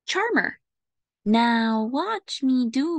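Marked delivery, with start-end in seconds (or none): 0.1-0.6 high charming voice. Acted. Chapter word sung out high.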